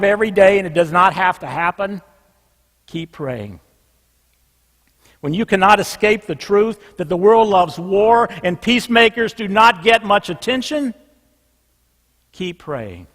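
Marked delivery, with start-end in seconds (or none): 2.9-3.6 low, soft, drawn-out refrain. Real, not acted. Preacher closing each case with a soft low refrain. 12.3-13.1 low, soft, drawn-out refrain. Real, not acted. Preacher closing each case with a soft low refrain.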